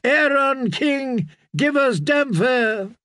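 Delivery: high, slow chant of acclaim; drawn-out calls, pitched well up; crowd cry. A narrator's reading. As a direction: chant it as a clamouring congregation. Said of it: "they cried".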